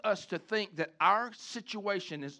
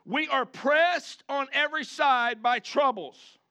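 slow emphatic reading; 7 semitones higher, 5 dB louder, slower than usual. Real, not acted. Reading verse slowly.

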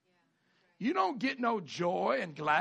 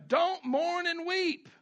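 forceful command; 11 semitones higher, 5 dB louder, much slower than usual. Acted. Pastor reads the command.

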